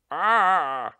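loud, high wailing "ah"; moan. A narrator's reading. Narrator voicing the noise in the chimney, loud moan. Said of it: "the moaning started again".

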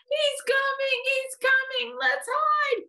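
put-on very high, panicky little boy; alarmed cry. A narrator's reading. Eddie flees the coming bear, crying in alarm. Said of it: "cried Eddie".

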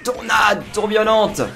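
high, slow, hammy move call; hyped announcement. Acted. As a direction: call out the special attack.

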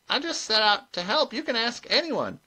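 high-voiced, mildly protesting old witch; high, humble self-defence; meek old witch. A narrator's reading. Granny protests in story.

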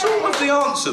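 high laughing exclamation. Real, not acted. Host laughing at the listener's silly joke answer. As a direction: burst out laughing on it.

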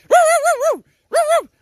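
loud high yips like a dog; dog barking. Acted. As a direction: bark at the bus like an excited dog. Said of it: "I always bark at school buses".

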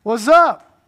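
Jesus calling Zacchaeus down from the tree. Retold or imitated. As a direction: call out to a man up in a tree, friendly.

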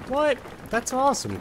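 surprised. Real, not acted. Surprised reaction to swim team.

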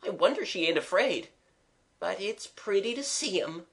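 high-pitched, spellbound running commentary; rapt voice. A narrator's reading. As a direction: marvel at each sight, enraptured. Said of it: "said Eliza in a rapt voice".

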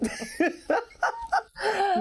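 high burst of real laughter; can't hold back a laugh. Real, not acted. Guest cracks up mid-answer, high laugh. Laugh between the two takes.

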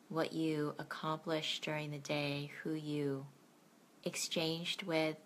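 gentle bedtime meditation guide; guided meditation. Acted.

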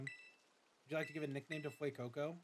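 usual speaking voice

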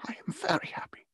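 high, soft, breaking into whisper; moved near-whisper. A narrator's reading. Bereaved father to his loving children, whispering. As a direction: whisper it, overcome with feeling.

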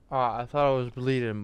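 ordinary talk, nothing marked

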